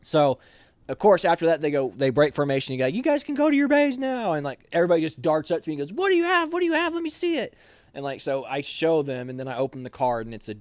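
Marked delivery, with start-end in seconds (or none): 2.9-4.3 drill sergeant dismissal. Retold or imitated. 5.9-7.5 very high, eager repeated question; eager trainees. Retold or imitated. Trainees crowd him eagerly.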